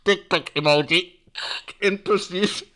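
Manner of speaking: loud, mock cop on the radio; police radio call. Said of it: "the police had to go".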